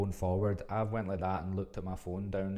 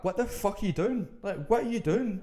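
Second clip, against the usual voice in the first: high, loud incredulous demand; retold outburst, pitched way up in exasperation; exasperated disbelief; 13 semitones higher, 6 dB louder than usual. Storyteller re-enacts confronting the date following along, exasperated disbelief.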